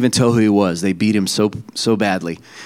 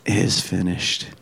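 slow, low and soft, as a last breath; dying last breath; 6 semitones lower, 7 dB quieter than usual. Retold or imitated. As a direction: retell Jesus's final words quietly, drawn out. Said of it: "he cries out with a, with this last breath".

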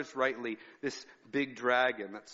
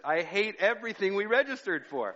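mock upset with laughter; 8 semitones higher, 5 dB louder than usual. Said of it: "She says".

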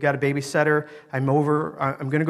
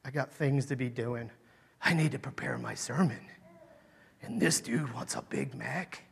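self-important inner thought; 3 semitones lower, 8 dB quieter than usual. Retold or imitated. Preacher voicing his first thought about the man, quietly self-important. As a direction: think it aloud, hushed and put-upon. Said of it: "My thought was".

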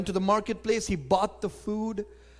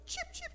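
tiny high-pitched cheeps, very soft; quick, faint mock birdsong; bird chirp; 15 dB quieter than usual. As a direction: imitate a little bird cheeping. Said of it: "a chirp of a bird".

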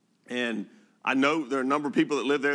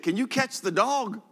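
put-on higher, slower little boy's plea; eager child asking; 11 semitones higher, 3 dB louder, slower than usual. Pastor imitating a boy asking him to catch the dog, higher child voice. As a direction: ask like a small boy chasing his puppy.

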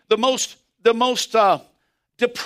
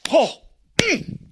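high excited "oh, mm" burst; excited exclamation; 7 semitones higher than usual. Real, not acted. Preacher catching himself, overcome with excitement. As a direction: let the excitement spill out in a sound. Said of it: "I'm sorry, I'm getting excited".

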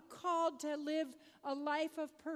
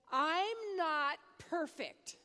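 slow, louder child's wail, lightly imitated; retold upset outburst; 3 semitones higher, 6 dB louder, slower than usual. Upset boy answering his mother, imitated distressed outburst. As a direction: draw it out like an upset kid.

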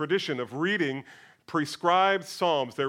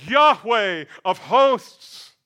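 loud, very slow, higher declaration; emphatic declaration; 6 semitones higher, 10 dB louder, much slower than usual. Acted. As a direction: declare the name with full force. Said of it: "declares emphatically".

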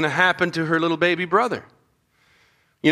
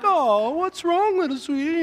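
put-on cooing at baby; 9 semitones higher than usual. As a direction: coo to a crying baby, much higher. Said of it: "women are like".

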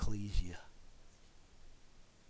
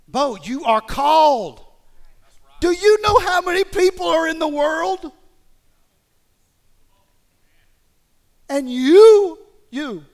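full-force preaching shout; 21 dB louder than usual. Real, not acted. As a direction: shout it out to the whole room.

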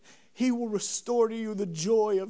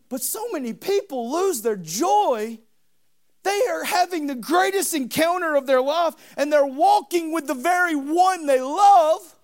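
louder, higher fervent sermon; preaching at full force; 9 semitones higher, 10 dB louder than usual. Acted. Preacher rouses the congregation about John twenty-one. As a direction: lift the church up with it.

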